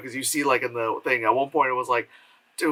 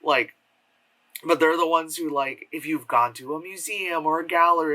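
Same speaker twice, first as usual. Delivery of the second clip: normal talking voice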